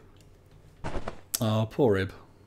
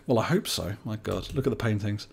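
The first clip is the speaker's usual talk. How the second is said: normal talking voice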